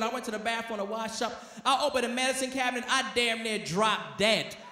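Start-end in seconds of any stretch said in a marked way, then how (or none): none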